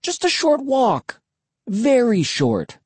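put-on high-pitched wheedling kid; coaxing, climbing high in pitch; pleading. A narrator's reading. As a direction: beg your sister to come along. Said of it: "I pleaded".

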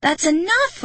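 high, forceful shriek; angry high-pitched outburst. A narrator's reading. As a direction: shriek it, out of patience. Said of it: "I shrieked".

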